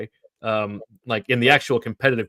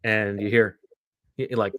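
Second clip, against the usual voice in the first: ordinary delivery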